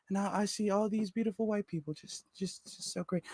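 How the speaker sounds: soft, floating, blissed-out whisper; dreamy mock rapture. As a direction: sound like you are levitating with joy. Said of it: "Like he started levitating".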